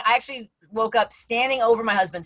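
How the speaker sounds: ordinary talk, nothing marked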